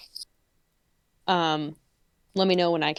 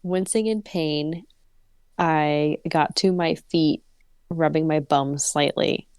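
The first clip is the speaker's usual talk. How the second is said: story narration; 4 semitones lower than usual. Acted. Reads the story aloud.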